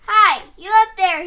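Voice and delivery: loud roar up to a giant; bawled. A narrator's reading. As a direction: bellow it upward. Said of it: "he bawled up at the giant".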